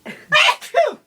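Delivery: loud sudden sneeze; a big real sneeze; sneezing. Real, not acted. Sneezing fit.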